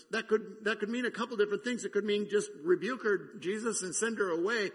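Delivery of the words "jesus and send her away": ordinary talk, nothing marked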